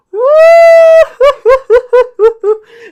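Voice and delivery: loud, high, sobbing howl; imitated wail. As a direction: let out a huge crying wail. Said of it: "just standing there just crying".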